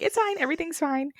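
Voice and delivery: high, falsely breezy everything's fine; chirpy denial in high pitch; mock calm cartoon voice. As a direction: play it like a cartoon on fire. Cartoon people panicking calmly.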